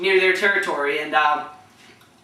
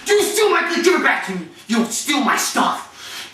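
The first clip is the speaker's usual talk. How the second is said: angry accusation; 3 semitones higher than usual. Retold or imitated. Grabs shirt, accuses cleat thief.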